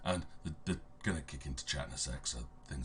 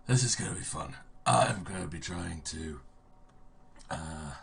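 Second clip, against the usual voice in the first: ordinary talk, nothing marked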